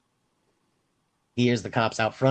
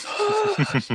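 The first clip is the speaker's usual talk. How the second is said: mock gasp. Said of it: "I'm like".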